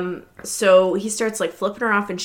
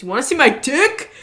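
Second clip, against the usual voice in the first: high, loud dare, building on the repeat; mock taunting yell; 8 semitones higher, 7 dB louder than usual. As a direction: taunt them, louder and higher each time. Retold taunt, repeated and escalating.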